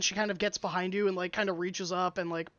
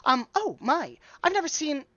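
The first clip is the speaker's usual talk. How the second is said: high, halting, wondering voice; awed dwarf shopkeeper; 6 semitones higher, 5 dB louder than usual. Acted. Dwarf shopkeeper seeing an unfamiliar kind of customer, awed. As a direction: trip over words in high-pitched amazement.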